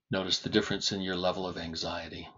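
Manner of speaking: ordinary delivery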